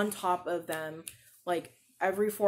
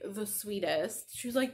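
ordinary talk, nothing marked

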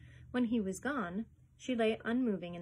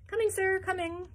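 high, loud answer from afar; nasal kennelman calling back; eager servant calling; 11 semitones higher, 6 dB louder than usual. A narrator's reading. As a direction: call back hurriedly from behind the buildings.

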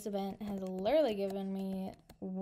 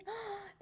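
gasp; 10 semitones higher, 7 dB quieter than usual. Real, not acted. Delighted gasp at cute pet.

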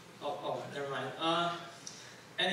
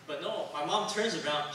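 ordinary talk, nothing marked

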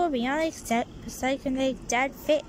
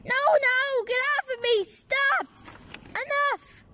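high, pushed protests at the dog; high scolding; 9 semitones higher than usual. Acted. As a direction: fend off the jumping dog, high and sharp. Dog jumps on him again.

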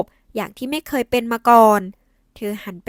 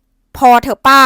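firm stern call; 10 dB louder, much slower than usual. A narrator's reading. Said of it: "เสียงเข้มดังขึ้น".

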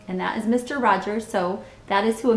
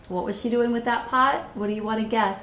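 ordinary delivery

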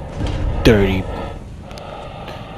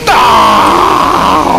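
sharp short cry of fright, higher and louder; startled yelp; 7 semitones higher, 6 dB louder than usual. Real, not acted. Player spotting the monster, startled yelp. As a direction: jump and yell.

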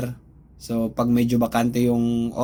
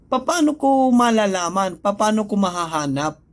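higher, heated retort; raised irritated voice; 6 semitones higher than usual. Retold or imitated. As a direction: push back at the boss with rising heat. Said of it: "yung tono ng boses ko, mataas na rin". (tumataas na boses, inis)

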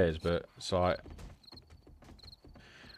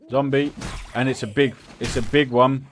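raised, panicked warning; alarmed shout; 6 semitones higher, 9 dB louder than usual. Real, not acted. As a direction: call out the danger in alarm. Warning about the zombie.

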